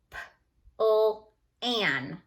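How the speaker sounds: slow sound-out of P-L-A-N; sounding out letters. Shown as a demonstration. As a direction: tap out P-L-A-N sound by sound. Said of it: "tapping out letters".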